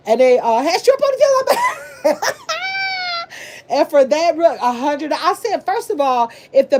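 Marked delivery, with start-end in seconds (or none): 2.2-3.4 a burst of high, breathless laughter; high cackling laugh. Real, not acted. Laughing at the video with her viewers, high cackle.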